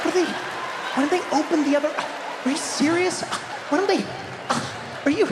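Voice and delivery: high, gasping disbelief; exasperated shopper, hammed up. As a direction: splutter in mock outrage, words left unfinished. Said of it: "they just get flabbergasted".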